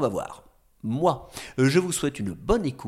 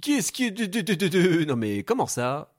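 flustered stammer; 3 semitones higher than usual. Acted.

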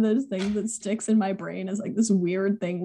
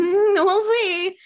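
coy dodge; 11 semitones higher, much slower than usual. Acted. Teen dodging question, coyly.

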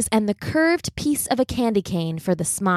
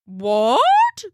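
surprised; 4 semitones higher, 4 dB louder than usual. Acted. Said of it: "said the big shaggy dog".

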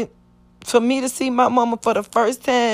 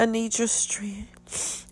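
whispered prayer; 10 dB quieter, slower than usual. Real, not acted. Praying in shock.

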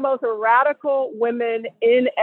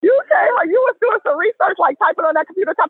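high, teasing praise; playful hype; 7 semitones higher than usual. Real, not acted. [name] teasing Vanessa about her list, high and playful.

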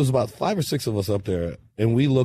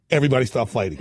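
normal talking voice

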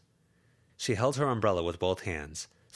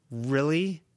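sharp, louder disbelief; indignant; 8 semitones higher, 5 dB louder than usual. Acted. Lucy to Verona, indignant. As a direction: challenge her flatly.

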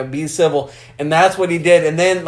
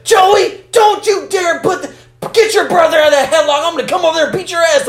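much higher, louder shrill mom yell; scolding Southern mom; 12 semitones higher, 4 dB louder, faster than usual. Retold or imitated. Imitating a Southern relative yelling at her sons, high mock scolding. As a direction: holler at the kids across the yard.

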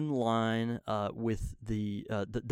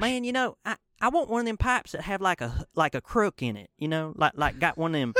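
put-on Mississippi accent; 6 semitones higher, 4 dB louder than usual. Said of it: "I'm gonna do my real thick Mississippi accent".

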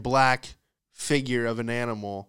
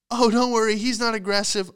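high, breezy parody of reassurance; mock reassuring owner; 9 semitones higher, 4 dB louder than usual. German Shepherd owner calming a stranger, high mock reassurance. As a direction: imitate an owner chirpily dismissing danger. Mimics oblivious dog owner again.

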